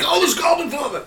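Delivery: high-pitched hammed-up scream; mock screaming. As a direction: scream it high, in pretend panic. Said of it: "like, screaming".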